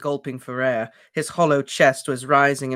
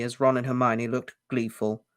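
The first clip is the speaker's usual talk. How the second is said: usual speaking voice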